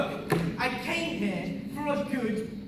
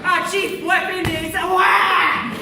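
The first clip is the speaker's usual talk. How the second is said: loud, hammed-up announcement; dramatic proclamation; 3 semitones higher, 8 dB louder than usual. Inquisition bursting in, hammed-up loud proclamation.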